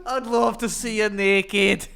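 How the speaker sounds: high, loud, slow Scottish parody; mock Scottish accent. Co-host echoes the failed seduction, high loud Scottish accent. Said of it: "Scottish is bad".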